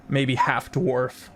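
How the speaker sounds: ordinary talk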